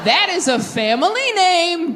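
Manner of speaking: high, slow mock-offended "Excuse me?". To a partner in bed, slow mock offense. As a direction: act scandalized, drawing it out. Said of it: "I'd be like".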